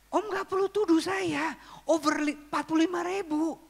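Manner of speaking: retold protest in a high, pleading pitch; indignant protest. Young musician protesting a false theft accusation, high and indignant.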